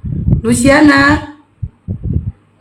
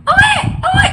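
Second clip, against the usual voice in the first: very high mock baby crying owe; baby wail. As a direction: wail like a newborn, high and thin. Imitates crying baby while nursing.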